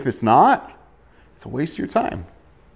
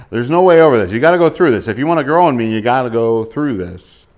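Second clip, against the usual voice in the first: loud, emphatic voicing of God's answer; God's firm insistence; 9 dB louder than usual. God answering someone who prays to get out of it, firm and loud. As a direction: insist on it loudly, driving each phrase home.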